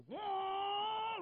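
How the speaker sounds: high, loud yelp of surprise; mock the other driver's sudden whoa; startled whoa. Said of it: "all of a sudden he goes".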